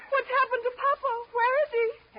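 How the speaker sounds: high, loud frightened pleading; desperate to know what happened; panicked questions. Acted. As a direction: daughter begging the pilot for news. Daughter learns father lost.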